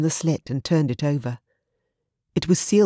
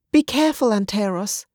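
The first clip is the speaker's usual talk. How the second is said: worried plea; 6 semitones higher than usual. Acted. Wife worried for husband.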